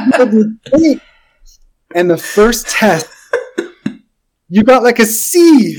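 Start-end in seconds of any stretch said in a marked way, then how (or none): 0.1-1.0 high, laughing mock boast; laughing echo.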